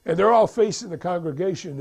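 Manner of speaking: ordinary delivery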